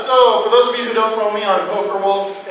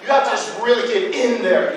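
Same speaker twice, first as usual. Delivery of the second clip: ordinary delivery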